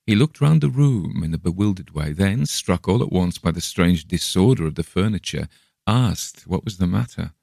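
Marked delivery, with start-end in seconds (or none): none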